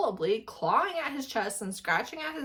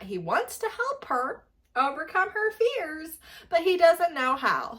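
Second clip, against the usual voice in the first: normal talking voice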